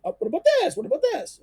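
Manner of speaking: high, mock-insistent Socrates pestering Meletus; needling Socrates. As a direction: badger him playfully with each question. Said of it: "Socrates keeps on, you know, saying".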